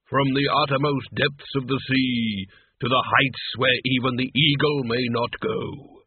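slow, solemn intoning; grandiose Death. Acted. Death telling Lezek where he is based, slow and grand. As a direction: declaim it slowly as Death, with grandeur.

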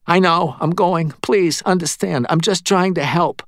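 high, hurried-sounding appeasement; placating medium. A narrator's reading. Medium placates angry Murphy while leaving, high pleading voice. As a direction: back off pleading, pitched well up. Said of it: "in a gesture of placation".

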